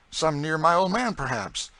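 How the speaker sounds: put-on "some near my old man" high and loud, "perhaps" left as a question. A narrator's reading. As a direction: float "perhaps" as a hopeful question. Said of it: "in the tone of a question".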